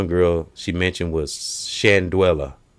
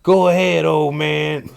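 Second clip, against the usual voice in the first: high, louder teasing taunt; mocking taunt; 10 semitones higher, 4 dB louder, slower than usual. Comic's granddaughter teasing grandpa, high mock taunt. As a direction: taunt the old man, pitched up.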